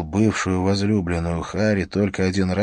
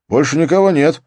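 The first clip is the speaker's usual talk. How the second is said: policeman's voice; 8 semitones higher, 6 dB louder than usual. Acted. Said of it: "раздался голос полицейского".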